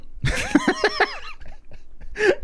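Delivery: shrill cackling laugh; high burst of laughter. Real, not acted. Laugh after the request.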